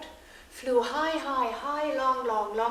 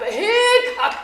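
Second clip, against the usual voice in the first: laughing hack chant; 13 semitones higher, 15 dB louder than usual. Acted. Millers hacking.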